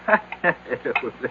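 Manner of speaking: quiet amused laugh under the breath; a brief, soft laugh at the idea; short soft chuckle. Acted. Doctor amused at drug suspicion.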